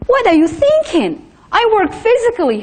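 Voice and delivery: angry husband. Retold or imitated.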